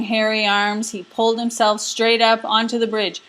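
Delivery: ordinary delivery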